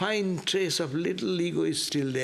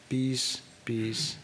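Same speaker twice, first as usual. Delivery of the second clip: fading benediction; 6 semitones lower, 4 dB quieter, much slower than usual. Real, not acted. Closing benediction after the chant.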